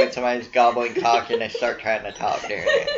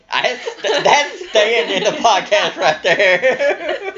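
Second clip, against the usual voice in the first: laughing; 9 semitones higher, slower than usual. Real, not acted. Laughing at roommate's Tinder line.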